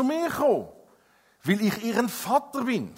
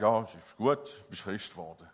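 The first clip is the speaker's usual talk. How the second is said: put-on low, complacent voice of the old self; a hint of smug complacency; old nature; 9 semitones lower, 4 dB quieter than usual. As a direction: lower and let it sound smug and satisfied. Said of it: "die alte Natur ... sagt".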